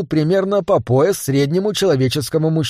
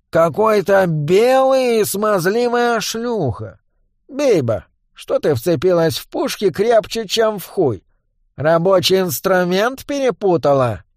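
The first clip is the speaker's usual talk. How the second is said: higher, slow sneering goblin voice; mocking goblin boss; 5 semitones higher, slower than usual. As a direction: jeer like a cocky little gangster. Гоблин глумится над Дарк. (гнусавый издевательский гоблин)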